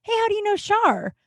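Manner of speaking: high-pitched casual query with SHAR falling; neighbor's curious question. Retold or imitated. Neighbor questioning the buddy about Shar, high and curious. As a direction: ask "how do you know Shar" high and evenly. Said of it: "he looks at my buddy and he goes".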